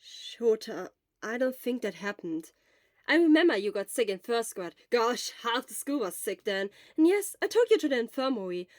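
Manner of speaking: loud, quick, eager friend; loud, urgent friend's voice; Hisashi, loud. Acted. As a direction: push it out fast and loud at the sick friend.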